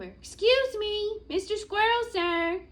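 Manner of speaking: high, loud, slow polite call; calling over. Acted. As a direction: turtle calling across the room. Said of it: "I called over".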